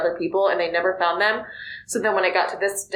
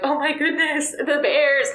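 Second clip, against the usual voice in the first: higher, amazed rush of realization; amazed gasp; 6 semitones higher, slower than usual. Retold or imitated. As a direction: gasp it like the twist just hit you. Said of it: "and you're like".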